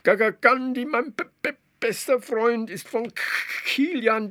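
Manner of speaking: high-pitched frog voice stuttering; excited stammer. Acted. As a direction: stammer nervously like a little frog. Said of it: "Atze stotterte vor Aufregung". (Atze stottert aufgeregt vor der Zaubermilch)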